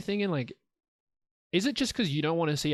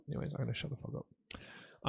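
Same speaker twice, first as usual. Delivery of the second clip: whispered aside; 12 dB quieter than usual. Real, not acted. Muttered aside while stopping himself.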